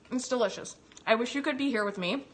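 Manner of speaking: normal talking voice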